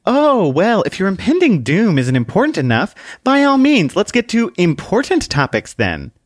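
high, loud sassy little lady; potent sass. Acted. Book sasses the man about his doom with potent sass. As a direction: drip with sarcasm. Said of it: "Book said with potent sass".

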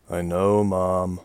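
weary and resigned; tired. A narrator's reading. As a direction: say it worn out, giving in. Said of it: "Marie said tiredly".

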